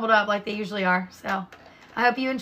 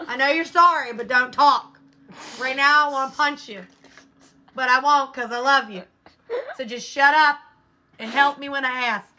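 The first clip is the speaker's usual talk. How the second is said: raised, higher retold telling-off; exasperated scolding; 4 semitones higher, 3 dB louder than usual. Mom to apologizing son, exasperated retold scolding. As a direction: lay down the law in mock fury.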